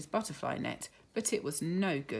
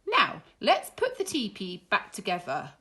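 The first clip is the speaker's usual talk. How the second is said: Miss Cluck voice; 10 semitones higher, 4 dB louder than usual. Acted.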